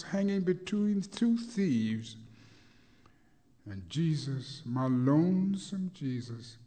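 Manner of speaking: chanting sermon with swelling peaks; preached poem. Acted. Rhythmic preached poem throughout.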